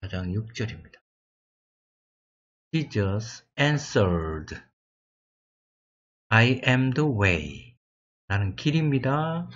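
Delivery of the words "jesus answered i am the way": very slow, word by word English; slow deliberate verse reading. Shown as a demonstration. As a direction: read each word out slowly for learners. Teacher reads English verse slowly.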